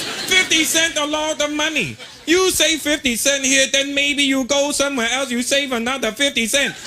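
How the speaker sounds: high, slowed mock Chinese accent; Chinese shopkeeper accent. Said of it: "He goes".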